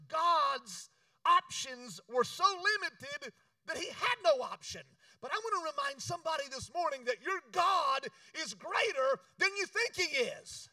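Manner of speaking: high, loud preacher's climax; preaching shout. Acted. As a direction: rouse the congregation to praise. Preaching at full force.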